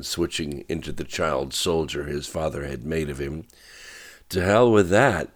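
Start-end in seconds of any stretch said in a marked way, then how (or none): 4.3-5.3 loud, higher angry snap; snapped. A narrator's reading. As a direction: bite it off, furious and set. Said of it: "she snapped".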